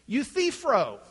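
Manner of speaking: "Euthyphro" with the center syllable stressed; middle-syllable stress. Shown as a demonstration. Teacher showing how Greek readers say it, "Euthyphro" stressed on the middle syllable. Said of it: "center syllable".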